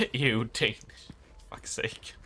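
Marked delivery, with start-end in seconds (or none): 1.5-2.1 whispered curse. Real, not acted. Muttered exasperated aside.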